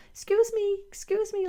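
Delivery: high, small polite voice asking nicely; high polite little voice. Pretend. Body politely asking for attention, high and small. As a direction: squeak it out like a timid little tap. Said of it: "So it's like going".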